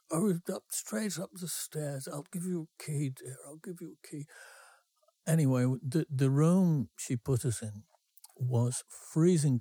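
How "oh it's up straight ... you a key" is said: lightly voiced landlady, fading to a hush; a hint of a drunk old lady giving the key, trailing off; tipsy landlady. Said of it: "Missus Ridges".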